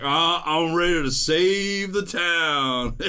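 loud, high, wailing drawn-out cry; dazed heroic boast. Acted. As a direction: stretch it into a woozy battle cry. Dazed hero firing off empty hands.

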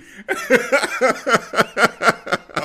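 big burst of laughter; loud laugh. Real, not acted. Laugh alone after joke.

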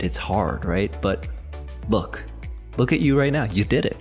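ordinary delivery

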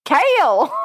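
high twangy country drawl; thick country accent. Retold or imitated. Mocking the co-host's reading of Kate, thick country accent. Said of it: "with a thick accent".

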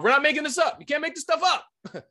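high-pitched, worked-up insistence; incredulous exclamation. Real, not acted. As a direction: exclaim it high, as if it is beyond belief. Exclaiming in disbelief mid-argument.

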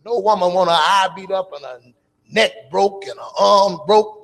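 usual speaking voice

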